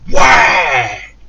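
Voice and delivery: explosive, high shouted "whack"; loud impact noise. Retold or imitated. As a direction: shout the crash of a kicked-in door. Imitates door being kicked in.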